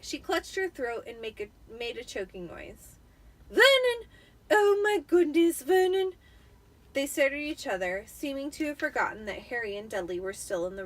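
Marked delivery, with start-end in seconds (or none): 3.5-6.1 much higher, loud shrill panic; shocked Aunt Petunia. Acted. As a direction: cry out to her husband in alarm.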